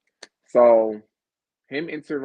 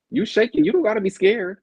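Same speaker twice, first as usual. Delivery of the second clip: higher, louder mock taunt; 11 semitones higher, 7 dB louder than usual. Host imitating the guest taunting Rivers, a high mock taunt. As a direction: needle him about shaking. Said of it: "you were just like".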